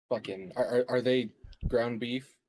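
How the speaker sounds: ordinary delivery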